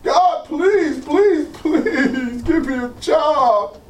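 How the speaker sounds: slow, drawn-out pleading; a hint of weary begging; imitated begging prayer. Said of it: "Third day".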